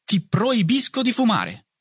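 high-pitched mother's rebuke; mother scolding her son for smoking; protesting. A narrator's reading. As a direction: forbid it sharply, voice way up. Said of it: "protestò lei".

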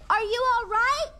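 high, hurried worried call. Acted. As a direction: call down anxiously to someone hurt. Checks on fallen friend.

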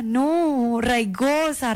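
normal talking voice

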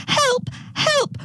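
very high-pitched storybook cries for help; cry for help. A narrator's reading. Girl flees the dingo, high acted cry for help. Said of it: "cried little Miss Emu Feathers".

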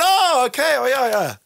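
high, loud mock relief from the orchestra; hammed-up relieved players; orchestra's relieved reaction.

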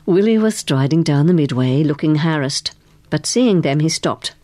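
usual speaking voice

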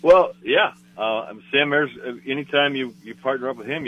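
ordinary delivery

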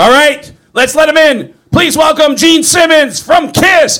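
announcer intro. Retold or imitated. [name] announcing [name] on air, loud showman. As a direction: welcome him like a big star. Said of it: "announcing the guest on air".

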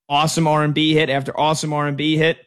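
ordinary talk

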